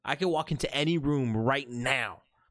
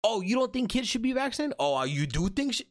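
mock indignant; 7 semitones higher than usual. Acted quarrel continues.

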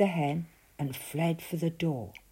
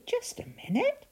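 much higher, surprised; sudden realisation. A narrator's reading. Narrator noticing the beanstalk, surprised and much higher. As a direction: spot something odd.

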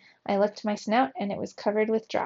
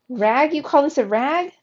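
higher, loud outraged scream; 6 semitones higher, 5 dB louder than usual. A narrator's reading. Geronimo outraged his silk pajamas are called a rag, screaming. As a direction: shriek in disbelief at the insult. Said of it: "I screamed".

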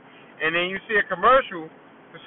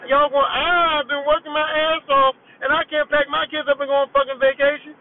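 outraged rant; 7 semitones higher, 4 dB louder than usual. Retold or imitated. Comedian shouts back at teen moms on TV, outraged.

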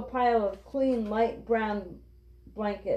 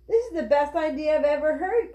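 higher, enthusiastic storybook voice; excited cry; 6 semitones higher than usual. A narrator's reading. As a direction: exclaim it with eager approval. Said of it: "cried Henry".

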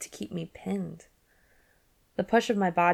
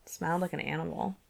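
plain reading voice

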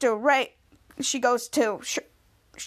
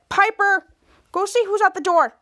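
high, louder order; bossy call; 7 semitones higher, 4 dB louder than usual. Retold or imitated. Henry sending Piper to the door, bossy call.

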